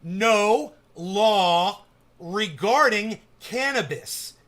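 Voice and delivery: slow, weighty repeat of "No law regarding cannabis"; slow emphatic slogan. Shown as a demonstration. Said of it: "I will say it again".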